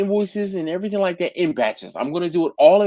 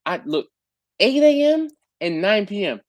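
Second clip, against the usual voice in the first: slow emphatic; 5 semitones higher than usual. Real, not acted. Emphasizing late hour complaint.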